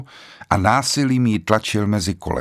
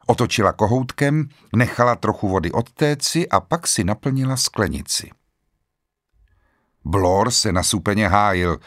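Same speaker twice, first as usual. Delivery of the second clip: plain reading voice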